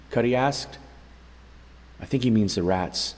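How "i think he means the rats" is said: ordinary delivery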